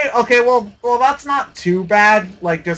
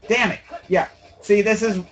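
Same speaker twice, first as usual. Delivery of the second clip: usual speaking voice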